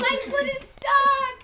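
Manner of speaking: high, loud, drawn-out wail; great drama. Acted. Trapped rabbit to anyone listening, loud dramatic wail. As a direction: wail it slowly, full melodrama. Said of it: "with great drama".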